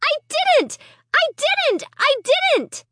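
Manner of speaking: high, pleading repeated denial; desperate wail. A narrator's reading. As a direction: wail it over and over. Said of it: "I wailed".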